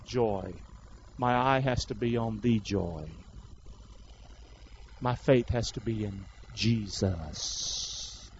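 low, soft, earnest sermon voice; solemn preaching. Real, not acted. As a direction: bring it down low and let it sink in.

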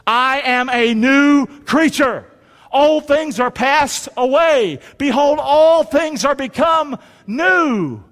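slow recitation, climbing high then settling; rising scripture declaration. Real, not acted. Preacher leads congregation through a verse, slow rising declaration. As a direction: proclaim it phrase by phrase for others to follow.

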